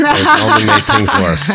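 words squeezed out through real laughter, pitched high; laughing. Real, not acted. Husband joking about the fridge poster, laughing through it. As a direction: crack up while finishing the punchline.

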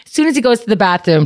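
ordinary delivery

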